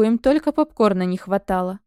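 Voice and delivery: ordinary delivery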